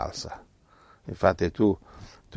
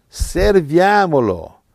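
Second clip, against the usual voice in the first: higher, louder, slow stretched cry; fiery preaching; 10 semitones higher, 8 dB louder, slower than usual. Acted. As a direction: stretch it out as an exhortation. Preaching climax. (esortazione lenta, alta e forte)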